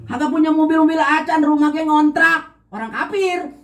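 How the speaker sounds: high, mock-pious squeaky voice. Preacher mocking a naive believer, high mock voice. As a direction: mimic a naive believer with scorn.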